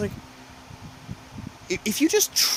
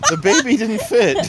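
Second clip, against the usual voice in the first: laughing; 7 semitones higher, 9 dB louder than usual. Real, not acted. Laugh on dark joke.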